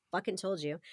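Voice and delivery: ordinary delivery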